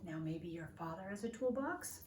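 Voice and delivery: ordinary talk, nothing marked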